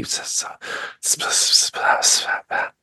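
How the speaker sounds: overlapping hissed whispers; voiceless, multiplying whisper sounds; many whispers. Acted. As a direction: hiss rapid gibberish like a crowd of whisperers. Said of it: "what becomes one becomes many".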